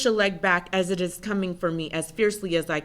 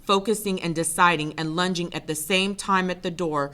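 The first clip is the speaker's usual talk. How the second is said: plain reading voice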